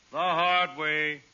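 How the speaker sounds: The hard way drawn out very slowly; slow knowing repeat. Acted. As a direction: linger over each word for the joke.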